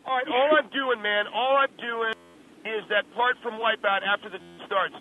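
ordinary talk, nothing marked

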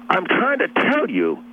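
high-pitched, drawn-out exasperation; exasperated insult. Retold or imitated. Retelling how he swore at his brother-in-law, exasperated.

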